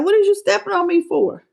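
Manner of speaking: high-pitched, mildly put-out question; lightly voiced lion. Lion asking the woman stepping on it, mild high-pitched protest. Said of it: "The lion was looking back like".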